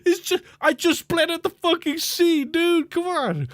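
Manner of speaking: high, whiny mock outrage; exasperated whine. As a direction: plead with the universe, comically fed up. Exasperated retort to his opponent.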